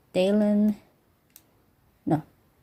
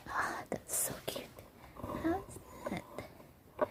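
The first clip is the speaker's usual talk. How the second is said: cooing whisper; 9 semitones higher, 17 dB quieter, slower than usual. Real, not acted. Cooing over cute bottle.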